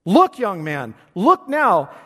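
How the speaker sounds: loud, high shouted call to look; preacher shouting at a young man in the gallery. Acted. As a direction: shout it across the chapel. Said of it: "And he shouted".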